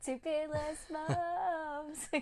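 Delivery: high, slow, stretched-out joke line; long, lifted, playful vowels; high drawn-out delivery. Acted. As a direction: draw it out high and silly. Mock delight at a joke line.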